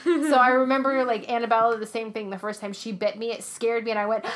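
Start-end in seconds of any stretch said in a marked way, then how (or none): none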